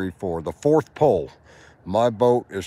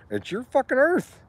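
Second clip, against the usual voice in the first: excited; 10 semitones higher than usual. Real, not acted. Pitch climbs cheering on listener.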